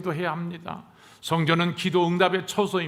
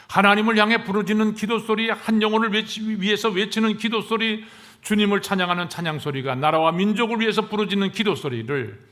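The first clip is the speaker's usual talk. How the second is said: high, loud sermon crescendo; impassioned preaching; 6 semitones higher, 5 dB louder than usual. Real, not acted. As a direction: preach it out urgently, raised and insistent. Preacher surges into forceful listing.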